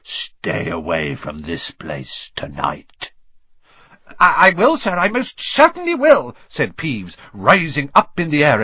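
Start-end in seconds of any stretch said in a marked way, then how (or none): 0.0-3.1 very low, slow, softened rasp; croaking. A narrator's reading. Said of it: "croaked Harry". 4.2-6.4 high-pitched, stammering obedience; eager grovelling. A narrator's reading. Peeves obeys the feared Baron.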